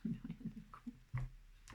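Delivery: "Plus rien ne compte" whispered slowly, no voice; slow hushed whisper. Shown as a demonstration. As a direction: breathe it out slowly as a secret. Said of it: "repeats it as a whisper".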